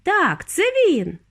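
high, drawn-out exclamation; excited cry. A narrator's reading. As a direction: call it out, stretching each word. Wonka keeps calling out excitedly.

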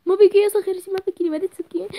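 worried mother. Acted. Mother asking her crying daughter what's wrong, concerned. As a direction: fuss over her child.

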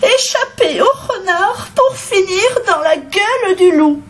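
very high, plaintive hen voice; wailing hen. A narrator's reading. Rescued hen lamenting her fate, wailing. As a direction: moan it in a high pitch. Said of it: "gémit-elle".

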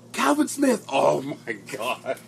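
high, loud cry of the name, then drawn out; real surprise at spotting someone; surprised recognition. Real, not acted. Spotting an old sergeant in the chat, surprised.

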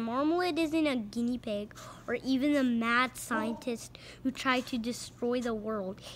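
urgent whispered warning. Acted. Crook whispering the danger to his gang. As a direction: hiss the warning under your breath.

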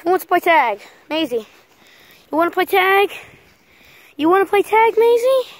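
loud, rising invitation to play; playful shout to a pet; excited dog talk. Real, not acted. Rousing dogs for a game.

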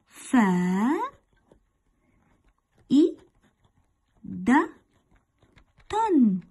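"فا، إي، د، تن" split into separate sound chunks; spelled out by sounds. Shown as a demonstration. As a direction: break the word into its sounds, one at a time. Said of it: "تحليل هذه الكلمات حسب ما بلفظ". (تقطيع الكلمة إلى أصوات منفصلة)

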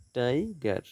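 "Tiger" as two beats, টাই, গার; split into syllables. Shown as a demonstration. Teacher dividing syllables for students, "tiger" split in two. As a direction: stretch and separate "tiger" after টাই. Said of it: "syllable বিভাজন".

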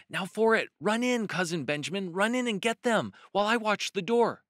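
high, loud rabbit voice urging a cousin on; loud, high storybook urgency; urgent urging. A narrator's reading. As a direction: send them in with an excited, high-pitched cry. Peter urges Benjamin to run in.

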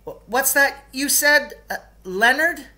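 high, startled stammer from the teacher; surprised teacher. Acted. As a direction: caught off guard, stumbling. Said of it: "The teacher was sort of surprised".

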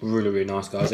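chewing while talking; speaking between bites; mouth full. Real, not acted. Seen 2: Took bite at -9; lips pursed, jaw working through labelled words.

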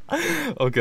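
okay squeaked out through laughter; high, laughing okay. Real, not acted. Still laughing at the sausage.

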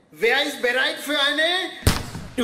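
slow, drawn-out showman announcement; dramatic host teaser; announcer build-up. Acted. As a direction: stretch it out like a game-show host. Host announces the scavenger hunt.

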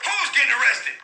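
ordinary talk, nothing marked

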